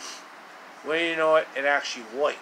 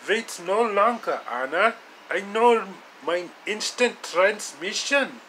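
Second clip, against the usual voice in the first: higher heroic voice, a touch louder; hero Yoshi voice, triumphant; 6 semitones higher, 4 dB louder than usual. Acted. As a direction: announce the rescue move boldly. Yoshi announces the escape.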